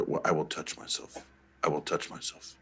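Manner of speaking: breathy whisper. Retold or imitated. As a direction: whisper it seductively. Quoting a sexy song line.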